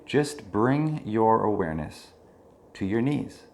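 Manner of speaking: unhurried meditation leader, easing down at phrase ends; guided meditation. Real, not acted. Leads a body scan meditation.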